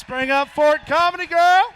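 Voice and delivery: high, loud, slow host call; emcee announcement. Real, not acted. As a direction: hype the next comic. Hands off to next act.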